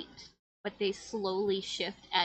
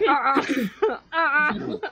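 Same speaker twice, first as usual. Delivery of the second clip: loud "Uh-uh" broken by laughter; laughing refusal; 6 semitones higher, 13 dB louder, much slower than usual. Acted. Ahsoka balks at the door, laughing loud refusal. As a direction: refuse through giggles, louder. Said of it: "Ahsoka's like".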